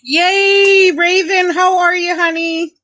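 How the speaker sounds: high, loud cheer of welcome; excited greeting. Real, not acted. Streamer welcoming a friend to the stream, excited greeting.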